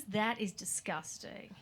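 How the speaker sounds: usual speaking voice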